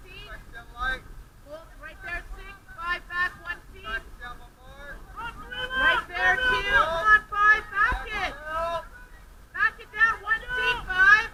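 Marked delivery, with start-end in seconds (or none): 3.8-11.3 loud, sharp commands to the crew; shouting commands. Real, not acted. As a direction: bark the positions across the dock. Steering crew on boat lineup.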